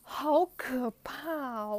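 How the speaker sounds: mock horrified. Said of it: "我真的是当下快哭出来".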